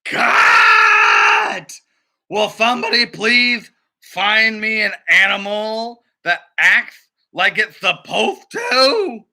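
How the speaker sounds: high, loud exasperated yell; furious shout. Acted. Duck director shouting at the animals, high furious yell.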